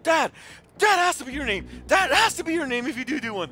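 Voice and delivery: loud, excited insistence; a kid who loves the idea; excited daughter. Retold or imitated.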